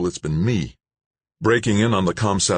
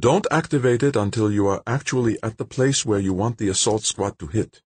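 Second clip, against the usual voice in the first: a higher character voice, carefully instructing; patient repeated explanation; 6 semitones higher than usual. A narrator's reading. Nobo telling Dan when to activate it, patient repeated explanation. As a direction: spell out the instruction, lightly in character. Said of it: "explained for the twelfth time".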